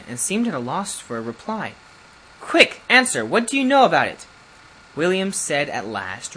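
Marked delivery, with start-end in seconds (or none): 2.4-4.3 sharp, high and loud impatient order; urgent demand. Acted. Lord Kelso demands an answer from William, high impatient shout. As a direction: snap at him to answer now, quickly. Lordship presses stammering servant.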